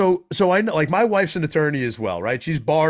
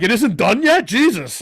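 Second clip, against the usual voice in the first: loud, high mock disbelief; imitating exasperated engineer; 8 semitones higher, 6 dB louder than usual. Host mimicking the engineer over the long résumé, mock exasperation. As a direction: blurt it as a fed-up colleague. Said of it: "Logan, the engineer, is like".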